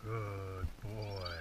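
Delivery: soft, low, slow praise; soothing praise. Real, not acted. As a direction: gently reassure the dog. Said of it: "so I'm gonna have him calm down".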